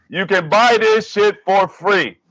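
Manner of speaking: ordinary talk, nothing marked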